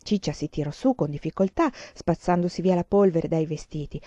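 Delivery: usual speaking voice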